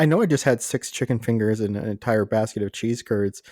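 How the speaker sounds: ordinary talk, nothing marked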